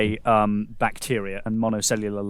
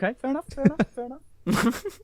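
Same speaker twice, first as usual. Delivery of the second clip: sing-song teasing; high mock voice; 12 semitones higher than usual. Mock reply repeated.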